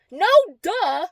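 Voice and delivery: high, loud sarcastic retort; exasperated, punchy no-duh. Retold or imitated. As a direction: state the painfully obvious. Sarcastic reaction in retold story.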